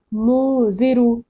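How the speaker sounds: मुजिरु with a long nasalized first vowel; slow मुजिरु, humming the noon in the nose; hidden noon. Shown as a demonstration. Teacher giving the next ikhfa example, nasal hold. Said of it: "नाक में छुपाना है".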